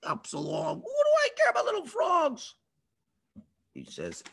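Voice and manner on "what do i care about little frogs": high, mocking king's voice; scoffing Pharaoh. Pharaoh brushing off the frogs, high mock. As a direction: sneer it way up high.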